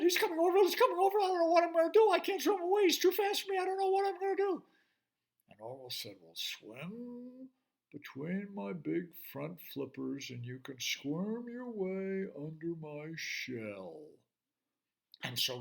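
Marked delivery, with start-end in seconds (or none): 0.0-4.6 very high, loud, frantic cry; high frantic fish voice; panicking little fish. Acted. Fish panics as shark comes. 6.2-14.0 calm slow turtle. Acted. Calm turtle shelters friend.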